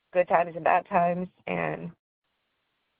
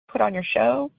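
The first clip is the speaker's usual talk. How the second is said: ordinary delivery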